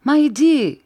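high, loud English call; a loud, high cry of endearment in English. A narrator's reading. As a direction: call out to him from afar. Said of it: "en criant".

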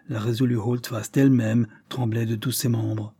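usual speaking voice

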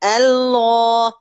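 الله slowly, first lam light and second heavy; light then heavy lam. Shown as a demonstration. Teacher shows the two lams of Allah slowly. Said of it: "اللام الأولانية مرققة".

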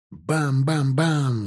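slow imitated cannon fire; cannon booms. A narrator's reading. Don cossack cannons firing, imitated booms. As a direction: drum out the booms like guns. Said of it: "забили ядрами пушки".

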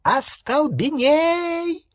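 shrill, long-held scream; piercing, drawn-out spell cry; screeched spell. A narrator's reading. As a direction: fire the curse off in a high shriek. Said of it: "завизжала она".

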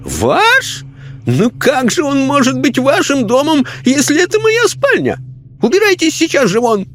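high, outraged lady's voice; indignant lady. A narrator's reading. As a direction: scold the intruder. Lady spirit speaks.

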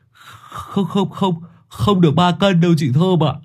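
high, stumbling stammer. A narrator's reading. Hải correcting chị Thơm about the rooster's weight, stammering. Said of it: "Thằng Hải lắp bắp".

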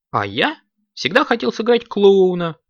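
high, plaintive complaint; sad, drawn-out. A narrator's reading. Nudik wanted to play a clown, high sad plaint. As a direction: draw it out in a high, sorry voice. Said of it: "грустно протянул Нудик".

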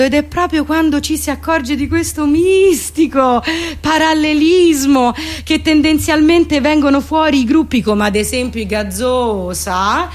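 usual speaking voice

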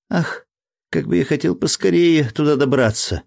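put-on high, eager little boy; excited child exclaiming. A narrator's reading. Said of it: "восклицал маленький Джек".

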